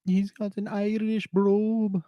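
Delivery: high, slow Irish brogue; Irish accent. Shown as a demonstration. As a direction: demonstrate an Irish brogue for copying. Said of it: "I need to hear it and then repeat it".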